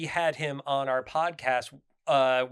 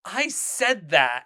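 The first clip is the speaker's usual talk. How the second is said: slow and louder; slow, emphatic; 5 semitones higher, 7 dB louder, much slower than usual. Retold or imitated. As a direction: say it slowly and firmly. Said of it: "she, like, paused for a second and she goes".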